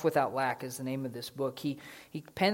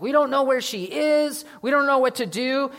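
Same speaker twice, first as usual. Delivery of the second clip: high, loud panicked dad; a high, loud mock panic; freaking out; 9 semitones higher, 6 dB louder than usual. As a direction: blurt it out in a panic. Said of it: "he's freaking out".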